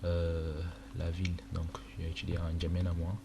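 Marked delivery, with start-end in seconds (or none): none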